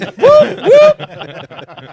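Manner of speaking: high, loud whoop breaking into laughter; laughing siren whoop. Shown as a demonstration. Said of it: "introduce you by going".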